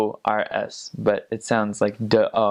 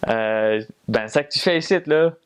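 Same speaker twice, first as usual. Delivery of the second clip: put-on Quebecois accent. A Quebecer asks what you are doing here, in a Quebec accent. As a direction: play a Quebecer rattling off joual. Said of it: "They'll be like".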